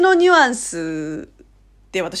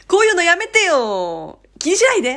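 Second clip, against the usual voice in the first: emphatic friendly protest; 8 semitones higher, 6 dB louder than usual. Shown as a demonstration. Korean refusing a gift, insistently protesting. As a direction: wave it away with stretched insistence. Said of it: "これも念押しだね".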